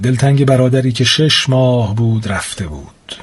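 usual speaking voice

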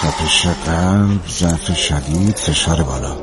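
noting symptoms. Acted. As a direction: list the symptoms slowly as if writing them down. Doctor notes symptoms to himself. (آهسته و شمرده، زیر لب مرور علائم)

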